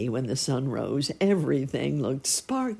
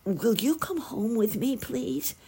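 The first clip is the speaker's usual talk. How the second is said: high-pitched, hopeful request; pleading sparrow; 10 semitones higher than usual. A narrator's reading. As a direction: ask sweetly, high like a small bird.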